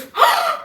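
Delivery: sharp high gasp of delight. Real, not acted. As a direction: gasp at the surprise gift. Opening the heels box.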